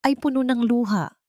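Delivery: plain reading voice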